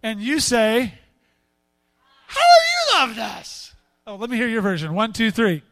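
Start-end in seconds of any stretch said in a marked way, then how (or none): 2.3-3.6 put-on high, loud, drawn-out teenage whine of rebellion; hammed-up bratty attitude, very high and loud; cynical jaded teenager. Shown as a demonstration. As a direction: throw it back like a teen sick of being told what to do. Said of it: "in your most, uh, cynical, jaded, teenage rebellion".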